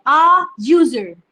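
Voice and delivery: आ यूजर, very slow, यूजर with a yu sound; slow teaching read. Shown as a demonstration. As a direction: draw out आ यूजर for the class. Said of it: "इसमें भी जे का sound आ रहा है". (आ यूजर धीरे, यू की आवाज़ के साथ)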